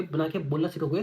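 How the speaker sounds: ordinary talk, nothing marked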